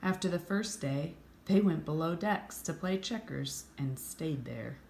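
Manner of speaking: ordinary delivery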